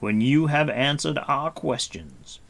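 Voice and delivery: dry and commanding; Gwydion's voice. A narrator's reading.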